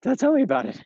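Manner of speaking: talking while walking uphill; breathless from the climb; out of breath. Real, not acted. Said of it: "which is why I'm out of breath".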